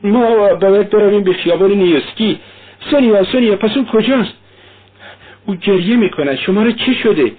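high-pitched frantic calling; acted hysterical outcry; distraught cry. A narrator's reading. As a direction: cry out in agitation, pitched well up. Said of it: "کاترین فریاد زد".

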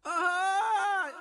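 a long far-off echo of a call. A narrator's reading. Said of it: "انعکاس صدای تری".

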